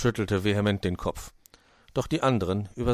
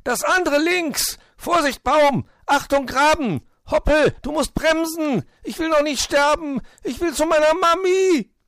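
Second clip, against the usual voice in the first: very high, loud shrieked warnings; panicked screaming; 9 dB louder than usual. A narrator's reading. Terrified Willi to his reckless driver, panicked shrieking. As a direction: scream for dear life, each warning more frantic. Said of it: "hatte sich die Kehle wund geschrien".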